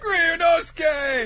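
long, high, loud wail of a name; mock grief. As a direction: cry out for a lost friend, overdone. Acted cry for the lost dragon.

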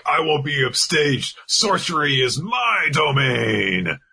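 mock-grand boast with drawn-out words; grandiose Chrome. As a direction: declare it like a hammy stage sorcerer. Acting out Chrome's grand challenge.

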